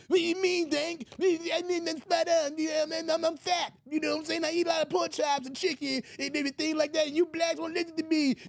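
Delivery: high squeaky whine; Roland Martin impression. Roland answering Tank about being fat, high whine. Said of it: "That sound like Roland spot on".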